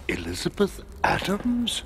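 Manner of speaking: "Elizabeth Adams" high, rising on Adams; questioning echo. Acted. President hearing who the young lady is, "Elizabeth Adams" as a high rising question. Echoes name just told, questioning.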